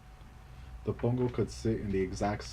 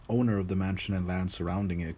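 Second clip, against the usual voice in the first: plain reading voice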